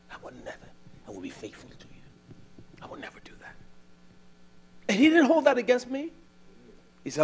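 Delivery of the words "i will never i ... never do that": hushed, unvoiced vow; whispered promise.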